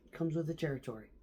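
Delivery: normal talking voice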